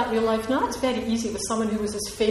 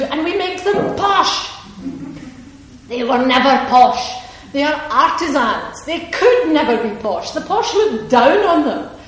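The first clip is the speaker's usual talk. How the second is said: much louder, rising indignation; passionate outburst; 5 semitones higher, 9 dB louder than usual. Real, not acted. Author to the audience, defending Clara, loud and indignant. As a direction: hammer home that they were never posh. Indignant rant about making artists posh.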